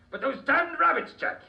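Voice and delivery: high, loud sputtering complaint; hammed-up royal fury; mock outrage. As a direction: rage at the rabbits like a batty king. King rants about rabbits.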